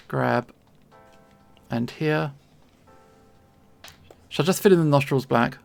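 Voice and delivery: ordinary talk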